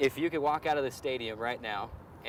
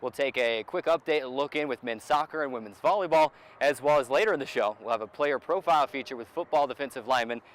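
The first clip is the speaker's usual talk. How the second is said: upbeat, louder host intro; show intro read; 3 semitones higher, 5 dB louder than usual. Acted. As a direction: sell the show to the viewers. Host opens the sports show.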